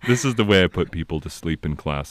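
low, sleepy radio-host delivery; mock-soothing low radio voice; late-night DJ voice. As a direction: lull them like a late-night FM DJ. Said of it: "late night F M D J radio voice".

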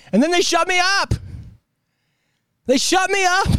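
very high-pitched, indignant squeal of disbelief; squeaky, exasperated complaint; incredulous outburst. Real, not acted. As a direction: voice shoots way up in protest. Exasperated outburst about being cut off.

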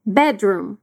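Bedroom said slowly, the D and R not fully joined; careful bedroom. Shown as a demonstration. Teacher modelling Bedroom, D and R kept apart. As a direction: give Bedroom a light D before the R, not merged. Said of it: "não junta completamente o som do D e do R".

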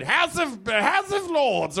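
high, slow pompous British naming; mock British. Comedian mocking the British show's title, slow mock posh. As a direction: drawl it like a stuffy lord.

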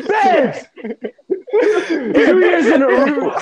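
high, laughing victory cry; laughing mock boast. Mocking a transgender champion, high and laughing. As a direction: crow about winning while cracking up.

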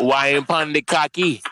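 milk the Caribbean lilt slowly; put-on Caribbean accent. Repeating the raunchy line for co-hosts, slow mock Caribbean accent. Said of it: "That make it sound".

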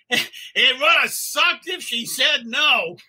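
laughing mock shout from above; a light retold shout down to the couple. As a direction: call it down half-laughing. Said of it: "Graham shouts down".

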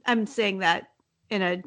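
ordinary delivery

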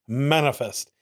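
mock-grand orator, starting high; slow theatrical proclamation, playfully imitated; Charles Doja impression. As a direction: announce it like a pompous statesman. Repeating the clip's grand declaration.

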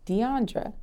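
"Deandra" said dee-AHN-druh; correct name pronunciation. Shown as a demonstration. Interviewee tells host how her name is said, correct pronunciation. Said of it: "The correct way to pronounce my name".

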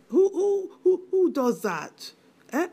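first who jumps high, indignant; outraged disbelief. Acted. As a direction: spit out the who in shock. Mother outraged at husband's will.

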